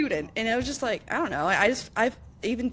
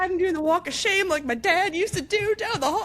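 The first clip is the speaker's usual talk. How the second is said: high, laughing retelling; laughing at doing the walk of shame like dad; 8 semitones higher than usual. Retold or imitated. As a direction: giggle through the line. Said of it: "I was just like".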